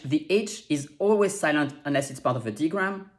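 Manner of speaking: ordinary talk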